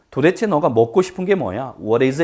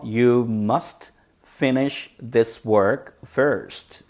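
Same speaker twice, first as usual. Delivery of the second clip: very slow, each word set apart; much slower than usual. Shown as a demonstration. Teacher modelling the example for students, slow and separated. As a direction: dictate it slowly with space between words.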